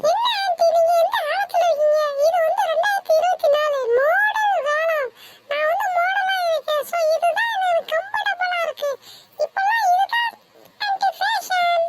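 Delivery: put-on chirpy trendy girl greeting her aunt; modern bride-to-be. Bride defends her outfit.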